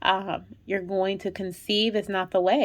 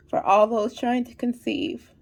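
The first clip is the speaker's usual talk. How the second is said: usual speaking voice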